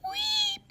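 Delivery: high squeaky weep call; guinea pig weep. Shown as a demonstration. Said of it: "Their cry of".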